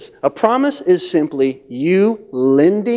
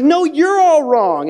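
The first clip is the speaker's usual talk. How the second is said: high, drawn-out contradiction; spies arguing back; 10 semitones higher, 3 dB louder, slower than usual. Acted. Ten spies contradicting Caleb and Joshua, high-pitched protest. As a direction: argue back in a raised, stretched voice. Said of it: "the other ten spies are like".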